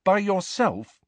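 high, incredulous question; amazed. A narrator's reading. Harry marvels that Hagrid acted alone, impressed. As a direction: lift it right up in wonder. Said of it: "amazed and impressed".

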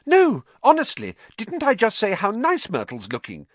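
high, loud protest, then easing; urgent, overeager denial; desperate insistence. Acted. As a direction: protest too much to cover a lie.

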